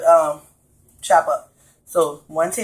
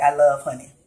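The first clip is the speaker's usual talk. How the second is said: normal talking voice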